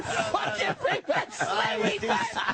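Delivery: real laughter through the words; half-whispered, slow and cracking up; laughing. Real, not acted. The host repeats the accusation against himself, laughing.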